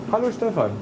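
bright high-pitched hello; high cheerful greeting. Real, not acted. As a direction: sing out the greeting. Greeting a regular customer.

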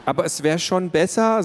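a mother pressing her point home, emphatic; with emphasis. A narrator's reading. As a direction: insist on it, leave no room for doubt. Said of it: "sagte Mama mit Nachdruck".

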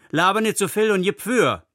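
high-pitched heckle from the crowd; calling out. A narrator's reading. Woman in the crowd heckles the preacher, calling out high. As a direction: call it out high, without full volume. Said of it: "rief eine".

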